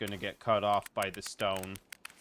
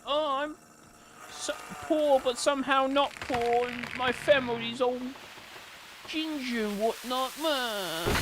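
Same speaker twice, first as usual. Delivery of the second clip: much higher, whiny mock voice; whiny Ron impression. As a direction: whine it out as a poor moaning Ron. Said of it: "Then you've got Ron that's like".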